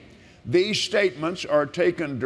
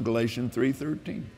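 normal talking voice

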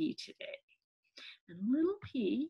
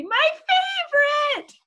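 put-on high, loud, stretched child's cry; delighted squeal; 11 dB louder, slower than usual. Little P getting favorite dessert, squealing with delight. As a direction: squeal it out, drawing out the joy. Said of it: "squealed Little P".

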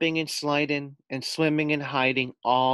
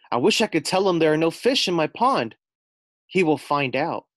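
higher, louder fretful voice; worried frog voice; 6 semitones higher, 6 dB louder than usual. Acted. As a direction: fret about the fisherman. Frog worries about fisherman.

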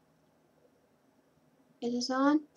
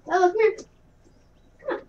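calling dog; 9 semitones higher, 7 dB louder, much faster than usual. Real, not acted.

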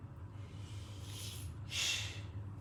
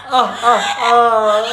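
being tickled; 10 dB louder, much slower than usual. Real, not acted. Gıdıklayayım mı seni?.

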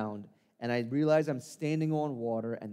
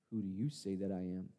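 softer, quiet and lower; solemn question; 5 semitones lower, 8 dB quieter than usual. Acted. Jesus looking Peter in the eye, quiet. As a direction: ask it gently and searchingly.

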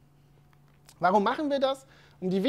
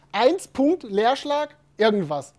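slowly spelling out eins Punkt Leerschlag irgendwas, word by word; slow dictation; 6 dB louder, slower than usual. Shown as a demonstration. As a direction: dictate each piece as if typing it. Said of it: "Sondern jemand hat geschrieben".

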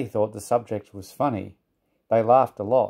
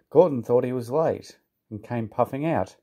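ordinary delivery

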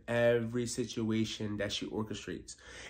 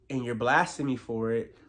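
ordinary delivery

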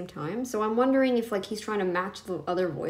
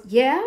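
"Yeah?" with a sharp upward lift and louder; rising "Yeah?"; 3 semitones higher, 9 dB louder than usual. Retold or imitated. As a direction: copy the clip's lilting "Yeah?" up at the end. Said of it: "I like when it goes".

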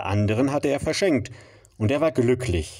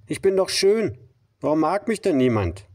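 complaining; 8 semitones higher than usual. Acted. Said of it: "klagte er dem Seestern sein Leid".

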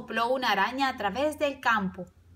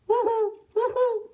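owl hoot; 13 semitones higher, slower than usual. A narrator's reading. Owl hoots in picture book.

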